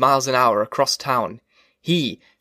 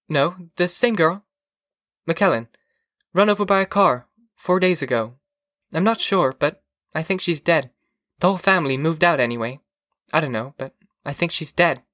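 higher-pitched, unconcerned wife voice; Mildred, offhand; 4 semitones higher than usual. Acted. Wife reports a neighbour's death to her husband, offhand.

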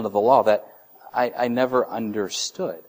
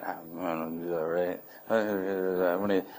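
sulky mumble; 5 semitones lower, 9 dB quieter than usual. Retold or imitated. Dad imitating his sulking kid, low and mumbled. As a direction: mumble it like a kid who won't budge.

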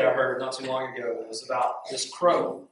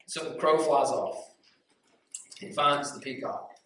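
usual speaking voice

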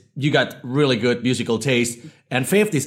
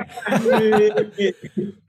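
high bright burst of real laughter; big laugh; 11 semitones higher than usual. Real, not acted. Host's bubbly laugh greeting guests.

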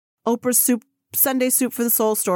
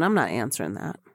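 stubborn inner voice; 11 semitones lower than usual. Retold or imitated. Oprah's inner thought voiced.

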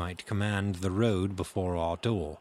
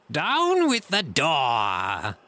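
slow, high, loud pirate shout; cried command; 6 semitones higher, 5 dB louder, much slower than usual. A narrator's reading. Blind beggar to his men at the inn, loud cry. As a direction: shout the order, drawing the words out. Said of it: "he cried".

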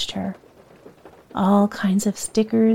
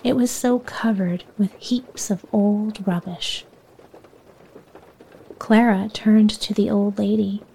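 ordinary delivery

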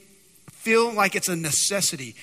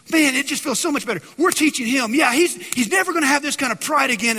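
gloating; 6 semitones higher, 5 dB louder than usual. Retold or imitated. Brothers gloat over Joseph.

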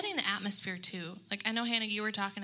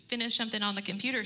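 ordinary talk

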